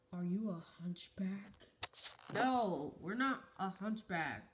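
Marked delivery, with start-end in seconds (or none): 0.1-1.5 soft whispered question. Acted. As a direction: whisper it in suspicion.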